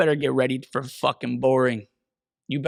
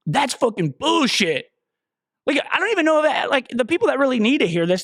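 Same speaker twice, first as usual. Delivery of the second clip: pitched way up, fired-up ranting; heated rant; 8 semitones higher than usual. Real, not acted. Ranting at podcast listeners about fitness, heated and high. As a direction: let the frustration push the voice up high. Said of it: "get into a rant".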